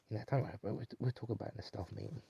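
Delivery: ordinary talk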